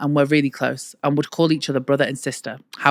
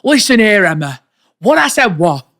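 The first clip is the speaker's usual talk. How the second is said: loud, higher northern dad telling off; northern dad impression; 4 semitones higher, 8 dB louder than usual. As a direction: bark like a gruff northern dad. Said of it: "pretend to be my dad".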